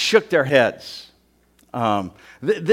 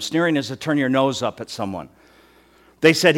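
ordinary talk

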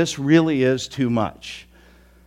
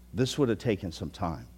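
softer repeat of "This would have taken some time"; quiet echo; 8 semitones lower, 10 dB quieter than usual. Real, not acted.

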